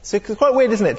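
ordinary talk

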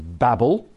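"Babel" said to rhyme with "rabble"; American pronunciation. Shown as a demonstration. Preacher showing how Americans say it, "Babel" as "babble". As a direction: say "Babel" with a short flat a, as in "cat". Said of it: "which the Americans always pronounce".

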